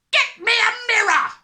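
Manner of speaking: very high, frantic full yell. A narrator's reading. Father desperate to see his hair, yelling. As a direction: scream the order in one burst. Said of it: "the father yelled".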